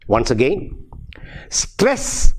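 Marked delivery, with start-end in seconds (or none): none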